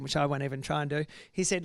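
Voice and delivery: ordinary talk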